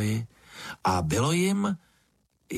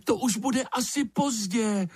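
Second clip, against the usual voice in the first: crying out; 12 semitones higher than usual. A narrator's reading. Lizard warns it will be too late, high cry. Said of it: "vykřikla ještěrka".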